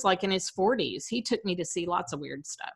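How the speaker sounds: ordinary talk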